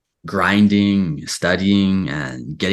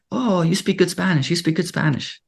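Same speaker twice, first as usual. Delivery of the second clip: much higher, light complimenting voice; 10 semitones higher than usual. Retold or imitated. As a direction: imitate locals cooing praise, pitched way up. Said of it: "all the Latinos were just like".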